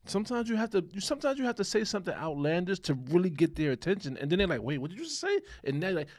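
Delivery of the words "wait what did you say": high-pitched startled question; acting out a listener caught off guard; startled double-take. Man suddenly hearing something outlandish, startled.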